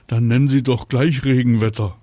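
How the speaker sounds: grumpy sarcasm. Acted. Papa mocks name.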